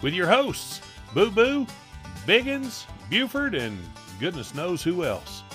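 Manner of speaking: hammed-up hillbilly show intro; drawled announcer opening. Podcast intro with music.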